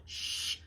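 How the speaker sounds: voiceless warning shush; hushing shh. A narrator's reading. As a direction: hush them with a sharp shh. Maya hushes the too-loud twins.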